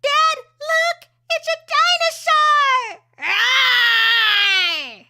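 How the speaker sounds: put-on very high, loud kid voice; little kid roaring at a toy dinosaur; excited child. Shown as a demonstration. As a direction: shout like a thrilled little kid. Second take, child voice.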